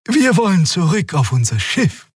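amiable Xia. A narrator's reading. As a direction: charm the guard. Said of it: "antwortete Xia liebenswürdig".

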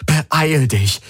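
high, urgent plea; pleading. A narrator's reading. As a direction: beg them urgently to come. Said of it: "flehte er".

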